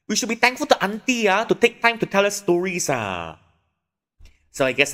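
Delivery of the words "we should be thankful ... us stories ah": put-on high, loud scolding child; scolding kid voice. Older kid scolding brother about auntie, high and loud.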